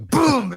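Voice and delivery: boom. Pretend. Co-host joking about showing the wrong way, with a mock gunshot. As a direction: blurt a gunshot noise.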